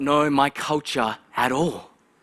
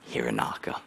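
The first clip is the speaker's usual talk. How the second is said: resigned whisper; 13 semitones lower, 9 dB quieter than usual. Retold or imitated. Boy gives in to mispronunciation.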